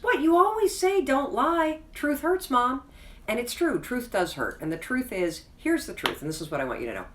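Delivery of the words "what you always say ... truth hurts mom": put-on cheeky teenage retort. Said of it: "And he goes".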